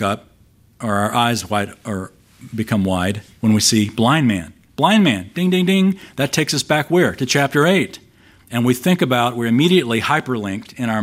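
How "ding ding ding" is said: higher, playful ding-ding-ding; a light sing-song alarm bell; mock bell ding. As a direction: ring it like a quiz-show bell. Bell-ringing recognition cue.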